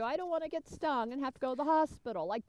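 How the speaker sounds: ordinary delivery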